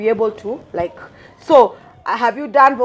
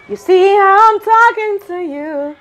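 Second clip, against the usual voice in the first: raised, slowed demonstration voice; demonstrating a voice; 9 semitones higher, 4 dB louder than usual. Shown as a demonstration. Interviewer showing how your voice sounds off mic, demonstrating voice. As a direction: pitch it high and stretch it out. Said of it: "unajua sauti yako venye, like".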